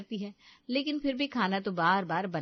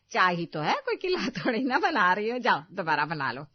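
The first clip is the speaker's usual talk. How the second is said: scolding; 4 dB louder than usual. Acted. Mother-in-law scolds.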